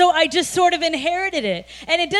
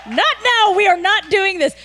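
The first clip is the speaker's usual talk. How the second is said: mock angry refusal; 4 semitones higher than usual. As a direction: brush him off, half-laughing and annoyed. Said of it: "And I am so mad".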